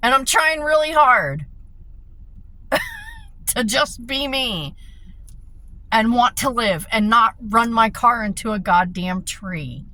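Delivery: anguished cry of someone at the end of her rope; desperate outburst. Retold or imitated. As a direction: pour out despair to her dad. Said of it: "I said".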